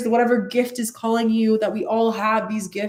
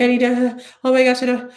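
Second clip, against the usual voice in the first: higher-pitched pretend panic; mock fluster; 5 semitones higher, 4 dB louder, faster than usual. Viewer who is channeling and trying to control it, mock fluster. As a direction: act out someone mildly overwhelmed.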